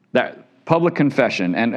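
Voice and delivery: usual speaking voice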